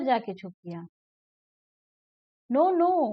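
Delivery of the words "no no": high, louder storybook refusal; lightly acted little rat protesting; mock protest. Rat answering the cat in the rhyme, high-pitched protest.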